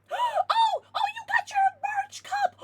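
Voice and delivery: high, loud delighted cry; thrilled high-pitched exclaim; excited squeal. Real, not acted. Excited reaction to viewer.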